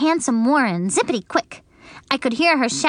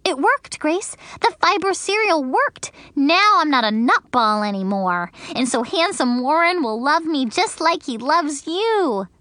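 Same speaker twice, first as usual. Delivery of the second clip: put-on higher childish voice, relieved and pleased; happy little girl; 6 semitones higher than usual. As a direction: announce the good news. Junie B's voice in the story.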